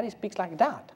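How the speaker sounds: lightly acted believer, fairly soft; understated know-it-all correcting someone; rival believer's claim. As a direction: make a mock claim, keeping it contained. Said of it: "God doesn't speak like that".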